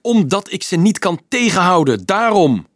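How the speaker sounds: high, loud furious shout. A narrator's reading. Boy screaming at the priest, beside himself with rage. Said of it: "schreeuwde Dolf".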